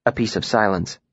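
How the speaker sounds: ordinary delivery, nothing marked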